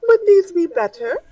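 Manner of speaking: a dainty merchant offering a fix; high shopkeeper voice. Acted. Shopkeeper assuring the party, high and fussy.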